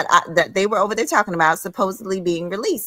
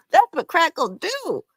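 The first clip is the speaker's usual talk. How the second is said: mocking repeat, even higher; 10 semitones higher than usual. Real, not acted.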